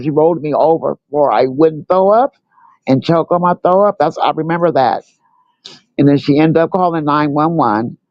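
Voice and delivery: ordinary delivery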